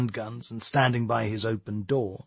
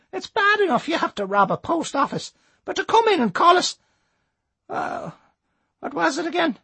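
put-on old Irish lady voice; 3 dB louder than usual. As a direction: scold like a cross granny. Old lady Missus Norton.

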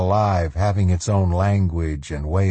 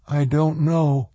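higher, unsure character voice; young woman's voice; 7 semitones higher than usual. A narrator's reading. Swan answering Carla about the tree, tentative.

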